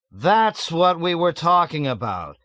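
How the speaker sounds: loud, high exclamation; cried out. A narrator's reading. Yossarian crying out in the back seat, loud. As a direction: burst out with it. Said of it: "Yossarian cried".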